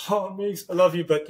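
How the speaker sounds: high-pitched, pleading love confession; seeking a reaction. Shown as a demonstration. Actor confessing love to a scene partner, seeking a reaction. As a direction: say I LOVE YOU high, reaching for a response. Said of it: "if you say it as a question, uh, as a reaction".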